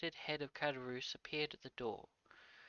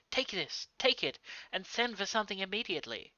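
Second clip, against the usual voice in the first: eager urging; 7 semitones higher, 8 dB louder than usual. Acted. Son urging father to take money.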